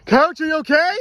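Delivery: high, loud shouted question; calling out. Real, not acted. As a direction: call across to check on the coach. Calling out to the coach.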